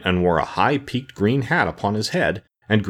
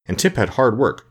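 plain reading voice

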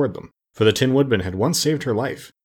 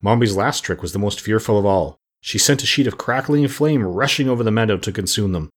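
usual speaking voice

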